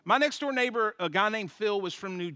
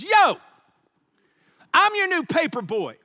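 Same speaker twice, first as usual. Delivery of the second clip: high, booming door-to-door pitch; loud paperboy greeting; 9 semitones higher, 9 dB louder than usual. Retold or imitated. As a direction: blurt it out loud at the customer, hammed-up. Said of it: "the first thing this guy says, he goes".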